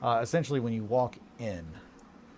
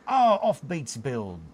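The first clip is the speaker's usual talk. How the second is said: put-on London accent; 4 semitones higher, 6 dB louder, slower than usual. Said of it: "just in time for the London accent".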